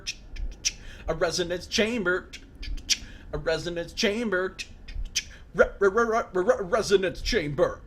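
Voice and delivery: stuttering resonance chamber on a rising laugh; giddy stammer. Real, not acted. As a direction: trip over resonance chamber, giggling. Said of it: "I don't know what just happened".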